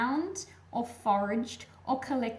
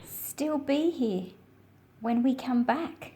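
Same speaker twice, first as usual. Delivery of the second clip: put-on reading to children. A narrator's reading. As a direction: read the picture book aloud to little listeners, unhurried. Reads picture book aloud.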